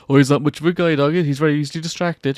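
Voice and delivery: loud, sing-song dismissive stranger; mock condescending. Mocking a careless stranger.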